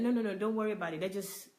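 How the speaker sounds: usual speaking voice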